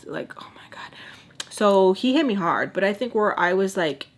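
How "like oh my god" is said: whispered disbelief, much softer; under the breath, fed up; exasperated whisper. Retold or imitated. Exasperated aside after retold argument.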